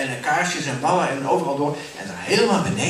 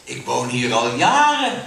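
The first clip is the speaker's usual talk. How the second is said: louder, smug handkerchief voice; 3 semitones lower, 5 dB louder than usual. Acted. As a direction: boast about having lived here for years. Zakdoek gaat verder. (zelfvoldane zakdoek)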